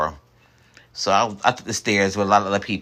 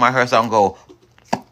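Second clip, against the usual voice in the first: usual speaking voice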